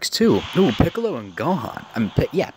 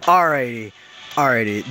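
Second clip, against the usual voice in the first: usual speaking voice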